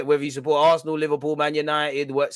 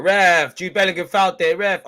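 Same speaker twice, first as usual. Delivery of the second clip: loud ref appeal; 3 semitones higher, 6 dB louder than usual. Real, not acted. Appealing to referee on TV.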